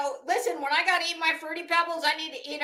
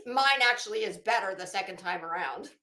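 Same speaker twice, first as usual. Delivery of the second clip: ordinary talk, nothing marked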